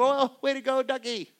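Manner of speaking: high, delighted praise with a laugh; cheering a duck. Retold or imitated. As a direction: celebrate the duck getting up. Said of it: "You go".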